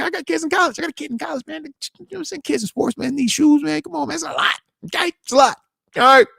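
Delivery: a put-upon dad listing expenses, voice pushed high; high-pitched complaint. Acted.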